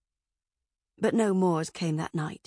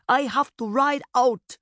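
loud, higher, firm declaration; commanding ruling; 5 semitones higher, 5 dB louder than usual. A narrator's reading. Mother leaving her daughter to lead the army, firm. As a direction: declare it with authority.

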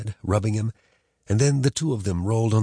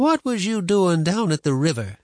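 put-on higher suspicious old woman; suspicious grandma; 9 semitones higher, 3 dB louder than usual. A narrator's reading. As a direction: question like a stern granny. Grandma questioning.

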